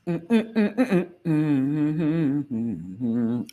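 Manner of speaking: repeated little hums trailing into a long one; humming. Real, not acted. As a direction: hum to yourself while busy. Hums to self while waiting.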